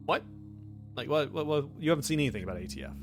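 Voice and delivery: ordinary talk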